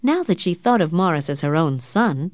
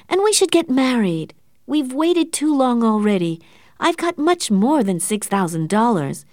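pleading near tears; 8 semitones higher than usual. Acted. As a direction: plead with him, on the verge of crying. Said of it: "and began to cry".

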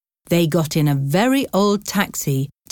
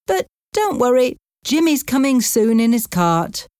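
higher-pitched Irish taxi driver; Irish accent; 7 semitones higher than usual. A narrator's reading. Taxi driver reassuring stranded passengers, in an Irish accent. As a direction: reassure them in an Irish lilt. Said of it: "the driver's Irish accent".

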